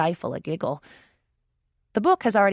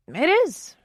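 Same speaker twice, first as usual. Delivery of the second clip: high, tight squeak; 12 semitones higher, 8 dB louder than usual. A narrator's reading. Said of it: "I agree in a squeak".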